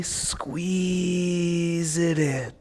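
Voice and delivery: slow soft drawn-out cue. Shown as a demonstration. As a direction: stretch the word out softly. Teacher cueing a squeeze, drawn out.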